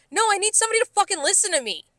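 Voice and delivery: high-pitched indignant rant; fed up, pitch jumping up, slowing hard on the demand; exasperated outburst. Retold or imitated. Server telling off the manager who never listened, exasperated.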